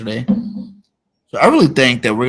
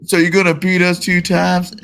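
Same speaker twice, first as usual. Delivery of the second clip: mocking; 7 semitones higher, 5 dB louder than usual. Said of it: "He's mad, too".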